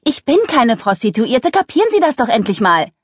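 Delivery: impatient, emphatic protest; exasperated insistence. Acted. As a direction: insist until it finally sinks in. Exasperated denial to persistent client.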